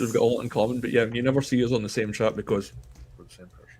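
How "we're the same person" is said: whispered punchline, no voice; stage whisper. Acted. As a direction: breathe it like a confession. Joke reveal, secret identity.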